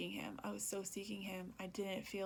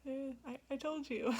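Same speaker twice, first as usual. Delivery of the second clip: light, higher-pitched I-told-you-so; not harsh; 6 semitones higher than usual. Retold or imitated. Imitating God's gentle told-you-so to her, playfully chiding. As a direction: chide playfully, about to laugh. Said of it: "a, a harsh way, but just, like".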